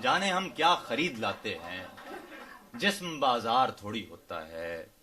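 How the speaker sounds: measured ghazal recitation, phrase by phrase; poetry recital. Acted. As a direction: recite couplets to an audience, unhurried and declaimed.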